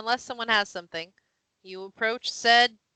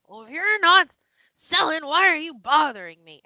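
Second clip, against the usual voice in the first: louder, higher crusty grumbling; crusty-bearded sailor; 6 semitones higher, 7 dB louder than usual. Acted. Sailor opens door on unwanted visitors, crusty annoyance. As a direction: complain loudly as an irked old salt. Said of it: "it's that voice and says".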